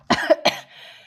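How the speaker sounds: sudden cough from swallowing wrong; choking cough. Real, not acted. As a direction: cough it out hard. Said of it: "J'ai avalé de travers".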